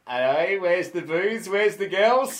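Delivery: loud, high cockney geezer; hammy East End shout; London gangster. Retold or imitated. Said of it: "he opened the door and went".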